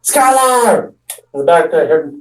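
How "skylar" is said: high called name; calling out. Retold or imitated. Kid calling his friend outside the house, called high. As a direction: call across the yard. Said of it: "And I yelled".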